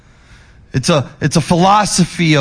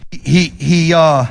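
ordinary talk, nothing marked

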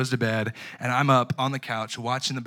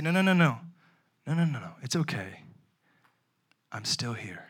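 put-on soothing a baby. Father soothing his fussy newborn, softening.

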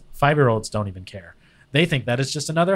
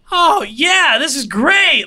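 much higher and a bit louder, gushing mock fiancée; imitating happy fiancée; 6 dB louder, slower than usual. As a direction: mimic her being stoked, pitched up high. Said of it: "she was just like".

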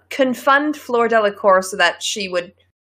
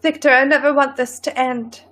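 higher, melodramatic lover's plea; dramatic proposal; 4 semitones higher than usual. Pretend. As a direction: plead like a hammed-up romance heroine. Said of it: "proposal stories are always so dramatic".

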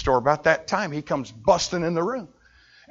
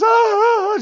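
high, loud wailing; grandma's wailing; 7 dB louder than usual. Retold or imitated. Grandma wailing at the bedside. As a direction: wail on and on.

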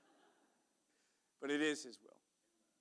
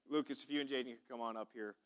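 usual speaking voice

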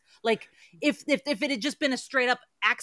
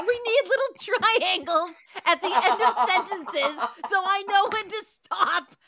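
higher, laughing mockery; laughing tease; 5 semitones higher than usual. Real, not acted. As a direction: tease him while cracking up. Mocking the reader, laughing.

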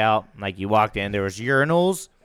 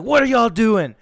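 raised, higher teacher voice barking the question; teacher's sharp call; 10 semitones higher, 8 dB louder than usual. Retold or imitated. Teacher catching kids in the bathroom, raised stern call.

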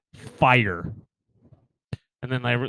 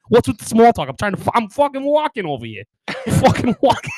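loud, high Boston bluster; Boston accent; 9 semitones higher, 7 dB louder than usual. Retold or imitated. Visitor scaring locals off, loud mock Boston accent. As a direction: rant like a hammed-up Bostonian. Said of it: "get the Boston accent".

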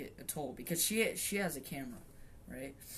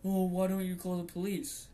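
mocking quote; 2 semitones higher than usual.

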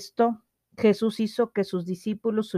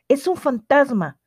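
frightened cry; 5 semitones higher than usual. A narrator's reading. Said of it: "gritaron llenos de miedo".

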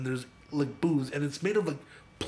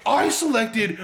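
fuming; 6 semitones higher, 5 dB louder, slower than usual. Retold or imitated. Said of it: "And so he's fuming".

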